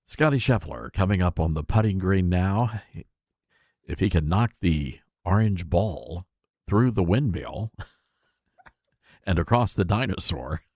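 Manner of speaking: low, measured golf announcer voice; golf commentator. Pretend. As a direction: call it like a golf broadcaster on the green. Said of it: "talk in a golf voice".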